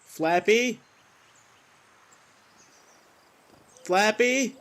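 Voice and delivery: high, rising searching call; questioning call. Acted. Man looking for his missing bird, calling questioningly. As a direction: call out uncertainly, pitch climbing at the end.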